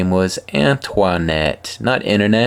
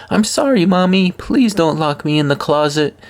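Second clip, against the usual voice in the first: put-on pleading child; 10 semitones higher than usual. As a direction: beg like a small child in the store. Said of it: "She randomly came out with".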